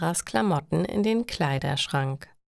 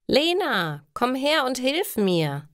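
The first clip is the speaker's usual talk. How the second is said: calling out; 8 semitones higher than usual. A narrator's reading. Ingrid ruft Lena herbei, laut und fordernd. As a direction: summon Lena to come and help. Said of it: "sagt Ingrid".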